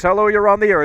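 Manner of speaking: radio host greeting. Acted. Radio host taking a call.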